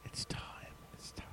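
hushed mystical whisper. Pretend. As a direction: breathe it like a secret.

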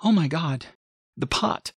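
high, aghast exclamation; shocked. A narrator's reading. Mother finds her pot cracked, high shocked voice. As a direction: gasp it out in dismay.

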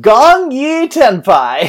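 drawn-out, high and loud hype call; slow, booming promo shout; excited catchphrase. Acted. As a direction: belt it out like a big announcement. Hyped catchphrase before playing promo.

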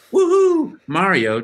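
high, loud Mario whoop; Mario woohoo. Retold or imitated. Signing for a fan with a high, loud Mario cheer. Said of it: "I said, like".

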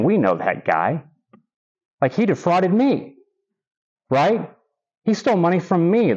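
lightly voiced accuser in the crowd; mock grumble from the crowd; grumbling crowd. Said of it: "they start to grumble".

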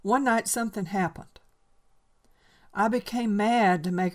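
put-on loud, high, intense old man's outburst; a lonely old man confessing in anguish; Enoch's confession. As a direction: pour out the confession in a rising frenzy. Reads Enoch's confession aloud.

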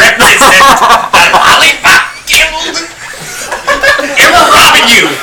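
high, loud squeaky little gangster; pint-sized mobsters shouting a hold-up; Munchkin Mafia. Pretend. Little fists at the door robbing the players, mock Munchkin gangster. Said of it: "It's the Munchkin Mafia".

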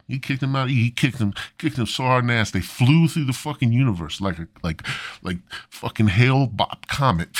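ordinary talk, nothing marked

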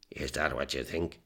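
lightly voiced drunk man mumbling; a hint of a slurred mumble; drunken mumble. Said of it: "he mumbled".

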